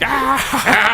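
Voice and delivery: high mock-teasing cry; mocking jeer. Parents making fun of their son, high imitated jeer. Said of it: "were making fun of me".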